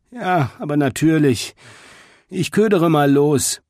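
high-pitched, put-upon agreement; sighing resignation. A narrator's reading. Porcupine told he is the bait, agreeing with a sigh. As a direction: agree as if sighing at your lot. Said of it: "Steve seufzte einmal tief".

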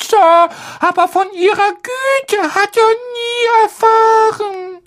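very high, slow, tearful elf; a moved little house-elf near tears. Acted. Dobby the house-elf speaks, tearful.

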